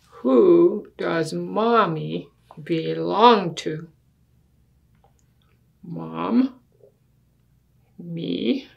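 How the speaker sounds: put-on lively storybook read in a child's earnest voice; reading to children. Child argues with Daddy over the big bed, earnest storybook reading. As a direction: read it like a kid making a serious case. Reads picture book aloud.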